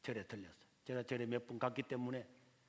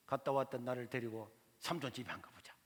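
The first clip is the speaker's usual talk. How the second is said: ordinary talk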